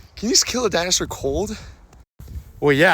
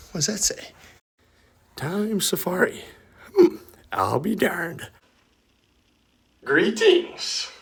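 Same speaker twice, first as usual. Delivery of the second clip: ordinary delivery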